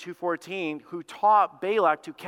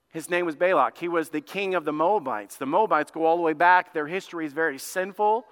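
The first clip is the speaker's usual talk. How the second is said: normal talking voice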